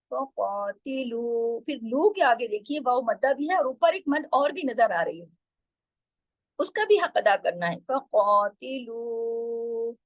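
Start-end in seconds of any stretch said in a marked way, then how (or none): 0.1-1.6 slow, stretched recitation of फ़क़ातिलू with a heavy qaf; slow tajweed recitation. Shown as a demonstration. Teacher reciting the word for students, slow and heavy. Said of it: "इतना खींचना है उसको". 7.8-10.0 फ़क़ातिलू with the final लू stretched long; long drawn recitation. Shown as a demonstration. Teacher showing how long to stretch, slow. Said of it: "इतना खींचना है उसको".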